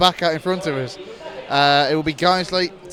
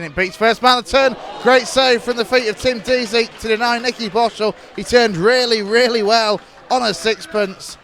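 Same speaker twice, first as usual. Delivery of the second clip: loud, higher, thrilled goalmouth call; excited commentary shout; 6 semitones higher, 5 dB louder than usual. Real, not acted. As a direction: shout it as the save happens. Chance and save in match.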